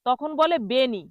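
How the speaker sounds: ordinary talk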